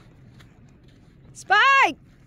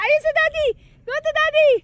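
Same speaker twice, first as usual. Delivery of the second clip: usual speaking voice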